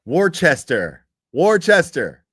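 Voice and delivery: loud, insistent "Worcester, Worcester" said as "Wor-chester"; Worcester insisted. Shown as a demonstration. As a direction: drill the word Worcester at chat. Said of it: "Everybody keeps typing what I'm saying".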